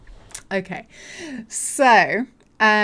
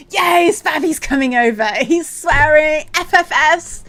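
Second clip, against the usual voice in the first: loud, raised, gleeful announcement; excited cheer; 6 semitones higher, 8 dB louder than usual. Real, not acted. Streamer celebrating a viewer coming over, excited cheer. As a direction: crow it to the whole chat.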